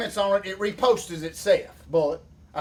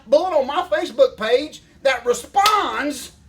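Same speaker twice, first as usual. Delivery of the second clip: usual speaking voice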